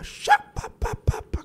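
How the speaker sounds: voiceless burst of percussive syllables; imitated speaking in tongues. Camila suddenly praying in strange tongues, imitated babble. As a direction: mimic someone breaking into tongues. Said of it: "começou a falar em língua estranha".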